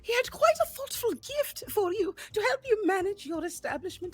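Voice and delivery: high, earnest plea to spare the brother; pleading charm. Acted. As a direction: plead like big Puss in Boots eyes. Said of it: "charm-filled face they can possibly muster".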